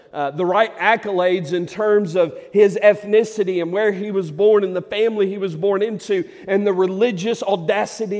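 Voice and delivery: loud, high, driving sermon delivery; a preacher pushing the crowd, loud and high; full-force preaching. Acted. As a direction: preach it hard to the congregation.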